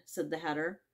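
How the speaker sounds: ordinary delivery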